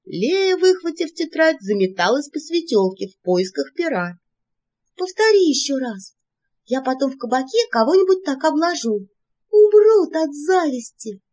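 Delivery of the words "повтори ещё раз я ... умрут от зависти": high, excited and urgent; eager. A narrator's reading. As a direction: beg for it eagerly.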